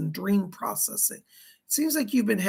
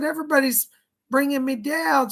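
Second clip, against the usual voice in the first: playful complaint; 6 semitones higher, 4 dB louder than usual. Retold or imitated. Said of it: "She's laughing when she says this".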